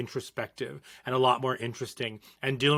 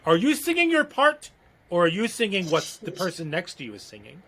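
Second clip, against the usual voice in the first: high-pitched opening, mock interrogation; choir teacher; 4 semitones higher than usual. Choir teacher questioning a student about their part, mock scolding. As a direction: imitate a choir teacher catching out a singer. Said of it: "your choir teacher coming out".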